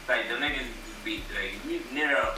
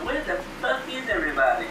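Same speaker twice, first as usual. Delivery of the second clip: higher, mock Irish exclamation; imitated Irish accent; 8 semitones higher than usual. Friend repeating the line that had him dying, Irish accent. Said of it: "He said that shit in the Irish voice".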